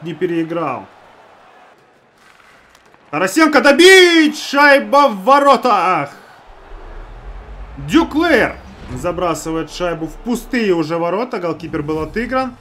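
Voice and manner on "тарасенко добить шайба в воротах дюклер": loud high goal call; excited hockey goal call. Real, not acted. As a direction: shout it as the puck goes in. Scoring chance then goal.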